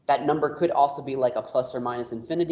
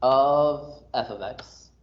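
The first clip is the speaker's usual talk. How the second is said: slow read-aloud with long pauses between phrases; reading notation aloud; much slower than usual. Shown as a demonstration. Teacher turning math symbols into a sentence for the class, slow read-aloud. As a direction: read it out piece by piece, very slowly. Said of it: "I read this out loud as".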